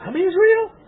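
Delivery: pitched high in disbelief; as if you can't believe it's true; high disbelieving question; astonished question. Retold or imitated. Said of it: "I was like, "Wow".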